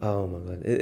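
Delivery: ordinary talk, nothing marked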